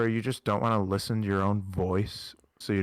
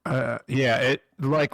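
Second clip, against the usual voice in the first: ordinary delivery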